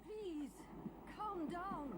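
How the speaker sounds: soft, high plea to calm down; hushed pleading. Acted. Woman soothing the shouting leader, soft pleading. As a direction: hush him with a soft appeal.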